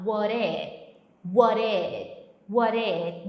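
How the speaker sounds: what it said "uórit" with R-like T; flapped T. Shown as a demonstration. Teacher showing the flapped T in what it. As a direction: tap the T in what it. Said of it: "quando ele vai falar esse what it".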